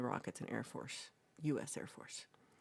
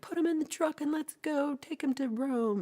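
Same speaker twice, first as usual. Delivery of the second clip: excited order; 12 semitones higher, 6 dB louder than usual. Retold or imitated. Group decides to drive.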